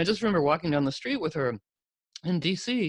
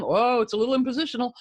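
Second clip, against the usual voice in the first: playful mock meter readout, jumping higher and quicker on the alert; impositional meter; 8 semitones higher, 4 dB louder, faster than usual. Speaker playfully acts out an impositional meter for the community, mock alert. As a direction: lightly voice a meter, then a higher, faster mock alarm. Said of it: "we can have the impositional meter going".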